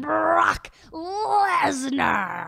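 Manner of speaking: hugely drawn-out, loud ring introduction; Paul Heyman. Shown as a demonstration. Showing off a favorite wrestling impression, drawn-out announcer bellow. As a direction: bellow the name, stretching each word very long. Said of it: "I love doing Paul Heyman".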